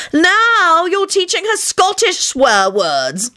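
wife wailing in exasperation. A narrator's reading. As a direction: wail in despair at the men. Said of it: "Elizabeth wailed".

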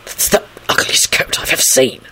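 urgent unvoiced hiss; irritated stage whisper. A narrator's reading. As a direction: whisper it sharply under your breath. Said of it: "he hissed to her".

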